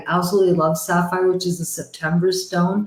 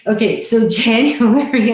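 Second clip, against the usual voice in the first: laughing; 4 semitones higher, 4 dB louder than usual. Real, not acted. Laughing through her own joke.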